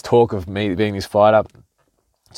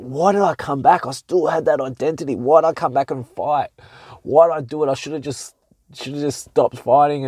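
higher, tormented self-questioning; anguished inner voice; 4 semitones higher than usual. Retold or imitated. Sleepless boxer berating himself in his head, higher anguish. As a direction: replay the regret as the thoughts race, pitched up. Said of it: "I was like".

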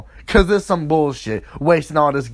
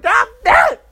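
very high, loud outburst; frustrated yell; 6 dB louder than usual. Retold or imitated. Cam reacting to being thrown out, high frustrated yell. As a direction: yell it in exasperation.

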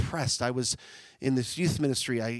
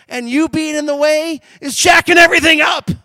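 loud preaching shout, higher and much louder; shouted rebuke; 11 semitones higher, 12 dB louder than usual. Real, not acted. Preacher shouting at the congregation, loud rebuke. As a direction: hammer it home at full voice.

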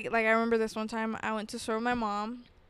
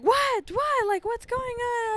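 high, confused mock-dad voice; flustered dad, squeaky and lost; 13 semitones higher, slower than usual. Dad in the car confused by his screaming kid. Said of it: "then he's like".

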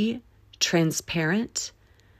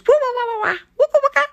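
put-on very high little-kid squeal and giggle; high-pitched giggly kid voice; mischievous kid; faster than usual. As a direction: play a gleeful child scribbling away. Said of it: "some kid ... comes along with a Sharpie and goes".

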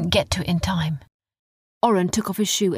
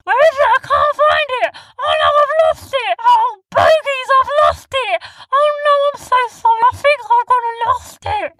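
very high, loud near-crying wail; frantic and sorry, splashing about; squeaky tearful shouting; panicking; 8 dB louder than usual. Acted. Sniz panics, lost the crystal.

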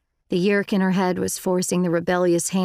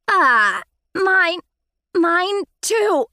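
pained alarm; 11 semitones higher, 6 dB louder, much slower than usual. Acted. Host slapping her own face.

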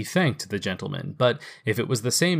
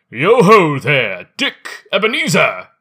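rich jovial voice; 4 semitones higher, 9 dB louder than usual. Acted. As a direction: boom it out like a fat, jolly employer. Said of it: "comfortable, oily, rich, fat, jovial voice".

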